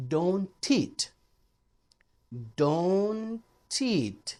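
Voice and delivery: don't teach with the t flowing into teach; linked don't teach. Shown as a demonstration. Teacher showing students linked don't teach. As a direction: join don't and teach smoothly. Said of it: "जैसे ये देखें".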